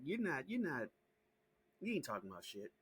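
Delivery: ordinary delivery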